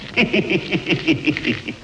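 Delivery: long, falling villainous chuckle; gloating laugh. Acted. Boss pleased with his henchman, gloating laugh. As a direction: laugh in drawn-out pulses, pleased with the deed.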